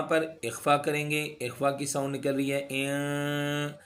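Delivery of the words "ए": soft ए hidden in the nose; nasal ikhfa sound. Shown as a demonstration. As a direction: breathe ए gently through the nose. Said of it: "नाक में आवाज़ को छुपाना है".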